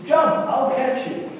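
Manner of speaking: loud, high, slowed call from the water; daddy calling out. Pretend. Daddy in the water urging his child, loud coaxing call. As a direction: coax the child to leap, loudly.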